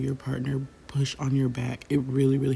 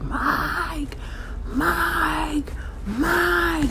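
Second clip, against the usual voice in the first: mock scream; much slower than usual. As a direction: imitate a panicked mother screaming for Mike. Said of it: "my mom was screaming".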